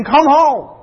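mock distraught plea, pitched high and loud; high, urgent retold cry; retold tearful plea. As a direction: imitate a wife pleading over the phone. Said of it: "sobbing and basically saying".

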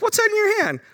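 very high, teasing question; high playful divine tease; playful God. Acted. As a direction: toss it off with amused confidence. Preacher voicing God's playful question.

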